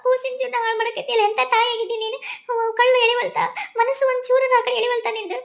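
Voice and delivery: put-on high, imploring old mother's voice; pleading old mother. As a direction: beg her not to leave the baby. Mother begs daughter-in-law to stay. (ಸೊಸೆಯನ್ನು ಬೇಡುವ ಅತ್ತೆ, ಎತ್ತರದ ಧ್ವನಿಯಲ್ಲಿ ಅಂಗಲಾಚುತ್ತಾ)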